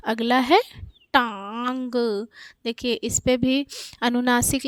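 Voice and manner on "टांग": टांग with long nasal आ, trailing ग; nasalised vowel demo. Shown as a demonstration. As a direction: stretch टांग through mouth and nose. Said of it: "ये क्या उच्चारित होगा".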